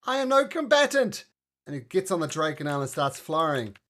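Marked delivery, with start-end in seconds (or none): 0.0-1.2 high, loud voice of a protesting non-fighter; loud, high-pitched protest; Calistarin's voice. Acted. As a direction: cry out in a high-pitched character voice. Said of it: "Calistarin says".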